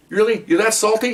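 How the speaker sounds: high, incredulous sarcastic jab; retold yelling. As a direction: act out mocking disbelief, pitch way up. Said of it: "And I'm yelling".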